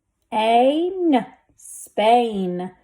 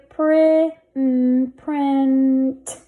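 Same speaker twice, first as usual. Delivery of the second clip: print stretched out, building from prin to the final t; blended slowly. Shown as a demonstration. Teacher sounding out a word for readers, blended slowly. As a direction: hold the n in print before the t. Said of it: "Let's read this".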